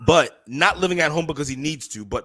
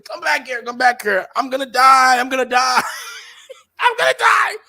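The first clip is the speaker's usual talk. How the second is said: hammed-up crying wail, much higher and louder; mock crying mother; 12 semitones higher, 8 dB louder than usual. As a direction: beg him to come back in an overdone crying wail. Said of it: "The mother's crying, saying".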